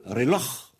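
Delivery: "reloj" closed on a throat fricative; "reloj" with rasped final jota; Spanish J. Shown as a demonstration. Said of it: "c'est le J espagnol".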